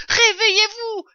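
high, very loud shout; alarmed yell. A narrator's reading. Father fox wakes his sleeping family, yelling in alarm. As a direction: yell to rouse sleepers in an emergency. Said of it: "hurla-t-il".

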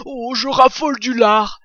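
loud badger voice, excited; excited cry. Badger dancing at the sight of bacon, excited cry. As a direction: exclaim with greedy excitement. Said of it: "s'écria Blaireau, dansant d'excitation".

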